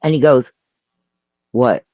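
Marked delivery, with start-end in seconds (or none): 1.5-1.9 low, unbothered reply; imitating a calm boyfriend; really calm. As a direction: answer calmly, pitched down. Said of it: "Like, really calm".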